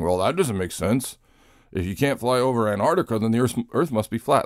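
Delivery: lightly mocking conspiracy guy; a hint of a know-it-all flat-earther. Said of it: "the flat Earth guy saying".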